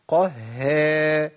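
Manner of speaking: drawn-out "قها" with a light smiling haa; thin ha. Shown as a demonstration. Teacher carries the lightness into alif, thin ha. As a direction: keep the long vowel of "قها" thin after qaf. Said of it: "وهذه حالة ترقيق".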